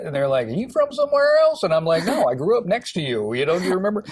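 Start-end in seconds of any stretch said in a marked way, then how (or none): 0.5-1.6 put-on much higher, louder quizzical kid voice; mock puzzled friends. Friends question his accent. 2.0-4.0 indignant protest. Retold or imitated. Protests to his friends.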